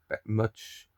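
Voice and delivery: ordinary talk, nothing marked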